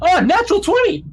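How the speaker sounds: real high, loud burst of delight; excited cheer. Real, not acted. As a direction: shout out a lucky roll in triumph. Player rolls a natural twenty.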